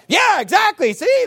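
high, loud gleeful gotcha; mock excited. Preacher imitates Witnesses thinking they've won, high excited mock. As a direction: crow it like you've won. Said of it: "They get all excited".

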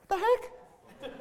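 high laughing what the heck; squeaky amused disbelief. Real, not acted. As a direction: laugh it out in mock shock. Laughing at hair suggestion.

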